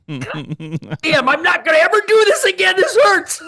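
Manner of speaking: high, loud, strained mock wail; pained outburst. Hunter reliving hauling a deer through muck, hammed-up pained holler.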